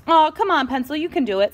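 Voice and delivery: coaxing cheer; encouraging Stapler. Acted. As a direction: urge a scared friend on. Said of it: "said Stapler".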